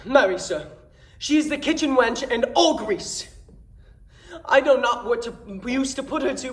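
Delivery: horrified comic complaint, punching the key words; comically aghast Dromio. Acted. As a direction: play to the house with big swoops and pauses for each joke. Performs Dromio's comic Shakespeare monologue.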